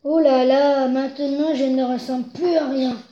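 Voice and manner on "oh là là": a loud dismayed oh là là; sighing groan. Acted. Muddy wolf groans at his brown reflection. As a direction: let out a big despairing groan.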